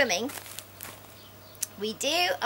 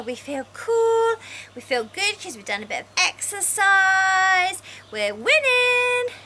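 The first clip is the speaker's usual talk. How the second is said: gleeful; 7 semitones higher, 4 dB louder than usual. Pretend. Pleased after exercise.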